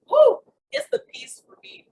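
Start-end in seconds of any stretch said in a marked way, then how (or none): 0.1-0.4 loud, high-pitched "woo"; excited whoop. Real, not acted. Coach celebrating the joy after a workout, excited whoop. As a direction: let out one big high whoop.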